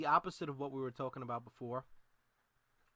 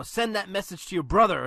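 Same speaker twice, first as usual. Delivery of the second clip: much louder and higher, full force; loud promo challenge; 7 semitones higher, 13 dB louder than usual. Acted. Imagined Cena calling out over the microphone, loud challenge. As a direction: belt it out like a wrestler's taunt. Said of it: "on the microphone".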